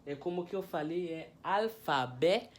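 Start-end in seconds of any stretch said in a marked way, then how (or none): none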